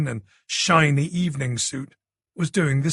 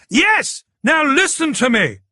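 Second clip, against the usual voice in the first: urgent shout; 11 semitones higher, 5 dB louder than usual. A narrator's reading. Shouting over noisy phone line.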